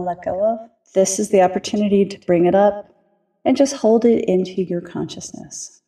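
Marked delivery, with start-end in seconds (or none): 2.5-5.8 calm grounding exercise. Acted. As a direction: ease them gently into their bodies, unhurried. Leads a grounding meditation.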